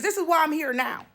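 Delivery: ordinary talk, nothing marked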